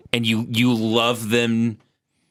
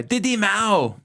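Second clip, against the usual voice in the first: put-on Vietnamese accent; 10 semitones higher, slower than usual. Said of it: "No, I'm way better".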